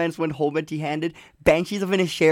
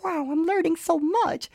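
put-on much higher, wide-eyed kid voice; high mock child gushing over a lesson; awed child; 12 semitones higher, 3 dB quieter than usual. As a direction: squeal it like an impressed little kid. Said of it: "I can see children being like".

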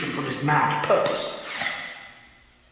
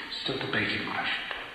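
usual speaking voice

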